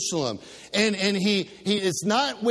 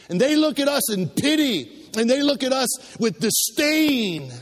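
fiery preaching; 4 semitones higher than usual. Acted. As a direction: build the sermon to a passionate peak. Preaching at full force.

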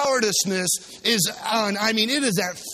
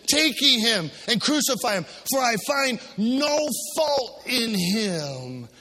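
Pilate's declaration; 3 semitones higher, slower than usual. Acted. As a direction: stretch the last line into a cry. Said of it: "Pilate saith unto him".

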